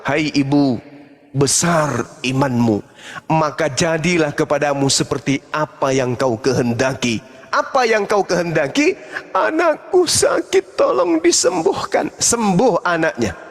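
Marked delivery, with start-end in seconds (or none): 0.0-7.2 deep solemn blessing; solemn Jesus. Retold or imitated. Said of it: "Yesus kemudian menjawab". 9.3-12.1 high pleading woman. Retold or imitated. Woman's plea voiced.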